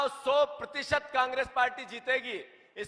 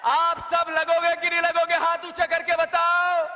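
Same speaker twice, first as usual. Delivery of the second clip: rallying shout; 4 semitones higher, 6 dB louder than usual. Real, not acted. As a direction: shout it out to the crowd. Rallying the crowd, repeated louder.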